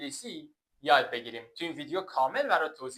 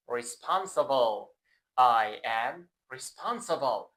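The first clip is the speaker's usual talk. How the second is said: slow model words; 3 semitones lower, much slower than usual. Shown as a demonstration. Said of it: "teacher models words".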